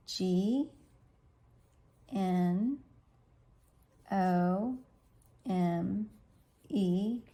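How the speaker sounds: G, N, O, M, E spelled letter by letter with pauses; spelling out letters. Shown as a demonstration. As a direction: spell "gnome" slowly one letter at a time. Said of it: "So gnome is tricky".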